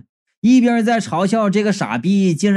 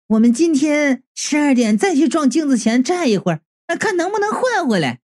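higher-pitched, coaxing proposal; acted suggestion; 7 semitones higher than usual. A narrator's reading. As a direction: raise the voice into a hopeful suggestion. Narrator voices own suggestion, higher. (提高音调的提议)

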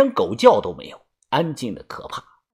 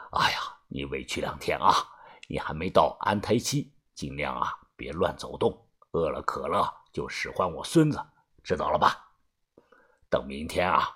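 much lower and softer, doting elder; caring old man; 11 semitones lower, 11 dB quieter than usual. A narrator's reading. Grandpa tells pregnant Xiaoxia to rest, low doting care. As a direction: fuss over her like a kindly grandfather. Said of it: "关心地说道".

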